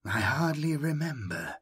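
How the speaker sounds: the survivor drifting in forgetfulness; distant ancient voice. Acted. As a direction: answer as if memory is lost. Ancient voice answers.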